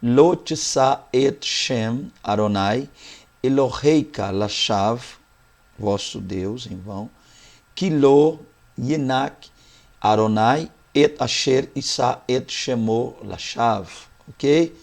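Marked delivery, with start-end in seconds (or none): 0.0-5.0 slow, syllable by syllable Hebrew Lo tisa et Shem Adonai; Hebrew reading. Shown as a demonstration. Teacher reading the Hebrew verse aloud to students, slow and careful. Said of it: "Então seria isso aqui". 7.8-13.9 slow, careful Hebrew Ki lo yenak Adonai; Hebrew reading. Shown as a demonstration. Teacher finishing the Hebrew verse for students, slow and careful. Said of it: "Então seria isso aqui".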